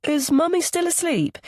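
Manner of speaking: put-on high-pitched small child's voice; little boy. A narrator's reading. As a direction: ask as a curious little boy. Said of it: "the boy plays with his hair".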